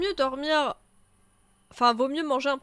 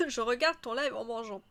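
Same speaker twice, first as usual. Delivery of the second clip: normal talking voice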